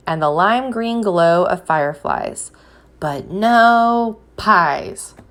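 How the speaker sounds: gentle storybook reading with a drawn-out refrain; picture-book read-aloud voice; reading to children. Acted. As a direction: read it to a class of little ones.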